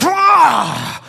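sudden high snarling roar at the ring; Bilbo grabbing the ring. Retold or imitated. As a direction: lunge at it with a wild high snarl. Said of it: "he's like".

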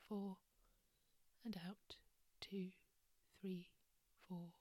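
soft whispered meditation voice; guided meditation. Acted. Leads a breathing meditation.

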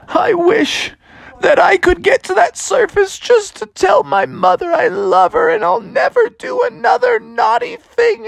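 hammed-up high, tearful begging; desperate plea. Drowning bear begs for one wish, high tearful pleading. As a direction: wail the wish, climbing to a squeal. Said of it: "And then he said".

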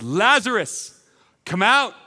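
ringing summons to Lazarus; loud voice. Acted. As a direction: call it out loud and clear for everyone to hear. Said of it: "He says in a loud voice".

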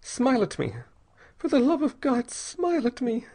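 put-on high, desperate girl's begging; frightened, pleading woman, pitched high; gasped plea. A narrator's reading. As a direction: beg urgently in a high voice. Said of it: "she gasped".

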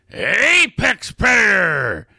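drawn-out macho battle cry; catchphrase shout. Acted. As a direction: bellow your own title. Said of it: "[name]".

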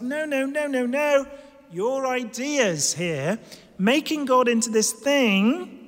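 high-pitched, insistent objection; Paul. Acted. Preacher as Paul rejecting their idea of God, insistent objection. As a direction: cut in with emphatic refusal. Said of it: "He's like".

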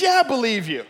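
loud, high acted agreement; eager shouted reply. Man on the branch answers God, calling up. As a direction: shout it back up quickly.

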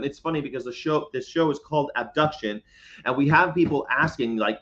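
ordinary talk, nothing marked

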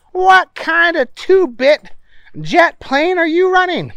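high, loud indignant demand. Acted. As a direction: demand it, outraged. Booger complaining to pilots.

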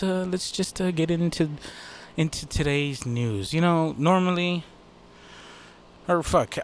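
usual speaking voice

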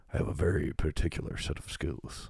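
light Liam Neeson menace; a hint of a grim hunter; Liam Neeson impression. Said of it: "almost sounds like Liam Neeson".

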